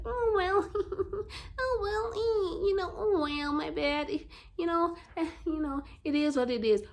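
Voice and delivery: high-pitched, giggly mock resignation; mock passive people. Mimicking people who let anything go on, mocking and high. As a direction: whine it high, shrugging everything off with little laughs. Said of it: "be like".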